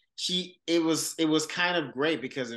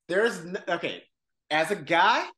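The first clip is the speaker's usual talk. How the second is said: ordinary talk, nothing marked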